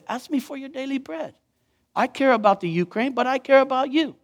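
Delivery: soft reassuring voice of God; tender God. Pretend. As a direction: hush it down tenderly. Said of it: "And God says".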